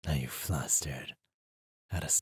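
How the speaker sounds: low breathy whisper, close in; teasing whisper. Acted. Demon probing the flustered mage, low whisper. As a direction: tease them softly in the ear.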